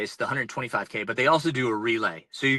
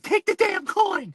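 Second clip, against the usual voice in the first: much higher, slow and emphatic; pitched-up mock exasperation; exasperated relief; 4 dB louder, slower than usual. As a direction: force it out in frantic relief. Ripping pocket open for the ferryman.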